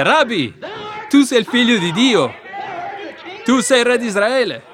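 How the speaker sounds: loud, high proclamation; fervent exclamation. Retold or imitated. Preacher voicing Natanaele acknowledging Jesus, fervently exclaiming. Acted confession of faith to Jesus.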